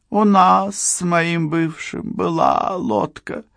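put-on high drunk woman voice; town drunk. A narrator's reading. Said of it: "пьяным голосом".

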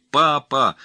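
loud, high cry for help; girl's cry. A narrator's reading. Girl grabbed by attacker calls to her father, loud high cry. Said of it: "закричала та".

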